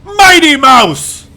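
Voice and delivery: loud, slow proud call; triumphant cheer. Real, not acted. Commentator cheers the mouse, loud and slow. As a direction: crow it like a victory cry.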